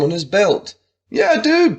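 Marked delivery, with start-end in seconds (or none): 1.1-1.8 much higher, loud bro cheer; excited cry. Acted. Buddy cheering Juarez on, high and loud. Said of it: "Buddy cried".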